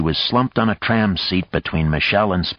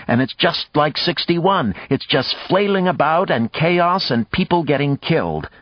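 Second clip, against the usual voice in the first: urgent argument; 6 semitones higher than usual. Acted. Maya argues the case heatedly.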